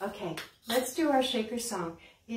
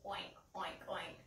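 soft, lower mock oinking; pig oink; 5 semitones lower, 15 dB quieter than usual. Librarian softly oinks like a pig for a child.

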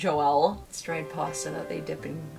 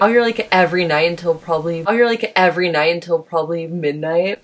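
louder repeat; 2 semitones lower, 8 dB louder than usual. Shown as a demonstration. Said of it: "repeats line louder".

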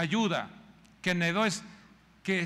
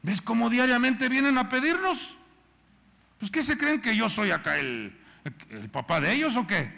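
fed-up husband; 3 dB louder than usual. Retold or imitated. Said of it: "Elimelec se enojó".

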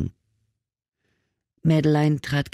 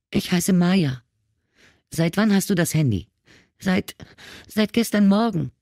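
high, tearful girl's voice, mildly acted; frightened girl sobbing to the ex-cop; 8 semitones higher than usual. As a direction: answer between sobs, voice pitched up. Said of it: "zwischen zwei Schluchzern".

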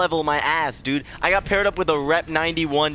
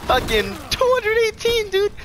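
much higher, disbelieving; high-pitched outrage. Real, not acted. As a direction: squeak it out in disbelief. Shocked at opponent's rep.

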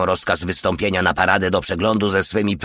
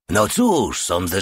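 usual speaking voice

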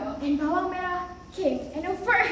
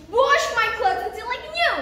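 high, louder demanding; rude stepsister; 6 semitones higher, 5 dB louder than usual. Acted. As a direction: order her about haughtily. Stepsister gives rude order.

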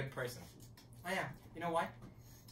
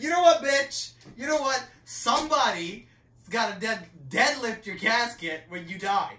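much higher and louder, yelling the insult, slowing on the last line; furious comeback; 9 semitones higher, 14 dB louder than usual. Retold or imitated. Comic retelling of yelling back at a taunting roommate, mock rage. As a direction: blast him with a mock-furious yell.

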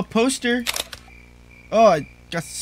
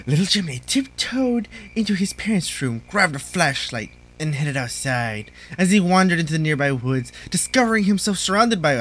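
mock storyteller voice; story narration; 2 semitones lower than usual.

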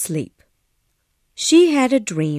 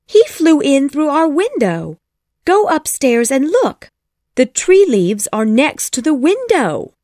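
put-on high, louder little girl's voice; insistent young Wendy; 8 semitones higher, 5 dB louder than usual. A narrator's reading. Wendy urges mother to go look, high insistent voice. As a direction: urge it in an excited child's high voice.

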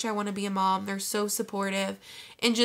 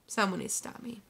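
whispered, softer; 6 dB quieter, faster than usual. Acted. Whispering a joking plea to the viewers.

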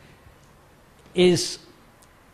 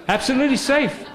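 mock reassurance; 8 semitones higher, faster than usual.

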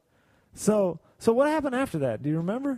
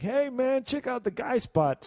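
higher-pitched mock interruption; pitched-up retold heckle from the sidelines; butting in; 6 semitones higher than usual. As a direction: imitate yourself barging in with a plug. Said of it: "I bust in like".

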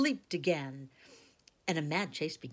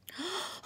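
shocked gasp. Acted. Girls see the broken table.

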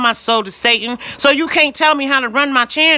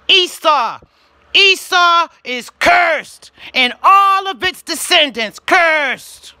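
preaching pronouncement; 5 semitones higher, slower than usual. Real, not acted. Pronouncing Esau's curse on YouTube, slow preaching voice. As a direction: pronounce the curse slowly from the pulpit.

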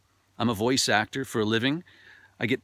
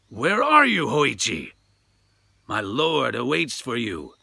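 booming samurai spirit searching; samurai demanding; 3 dB louder than usual. Acted. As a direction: demand he show himself.

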